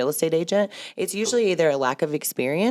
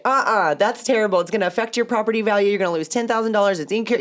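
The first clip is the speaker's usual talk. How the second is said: mock lecturing; 6 semitones higher than usual. Broker imitating herself lecturing an agent on the phone. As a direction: rattle off dire warnings. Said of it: "And I'll just be like".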